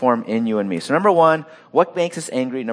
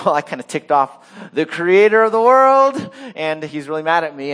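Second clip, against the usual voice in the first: high, louder sheepish admission as Jonah; sheepish confession; 4 semitones higher, 4 dB louder than usual. Retold or imitated. Jonah telling the sailors, sheepishly owning up. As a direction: own up like a kid caught out. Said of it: "And he says".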